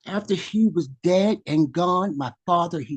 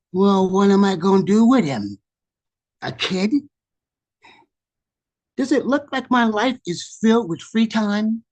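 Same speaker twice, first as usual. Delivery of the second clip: higher-pitched, put-upon protest; exasperated self-defense; 6 semitones higher, 4 dB louder than usual. Acted. Man answers the gentle voice about the kid, exasperated. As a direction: protest as if asked the impossible.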